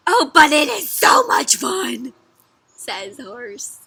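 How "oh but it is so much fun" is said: loud, drawn-out insistence; super excited. A narrator's reading. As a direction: gush loudly as an excited horse. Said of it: "horse sounds super excited".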